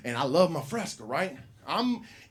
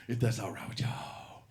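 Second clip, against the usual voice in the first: soft unvoiced aside; hushed aside to the room; whisper; 7 semitones lower, 10 dB quieter than usual. Real, not acted. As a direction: whisper it to the crowd.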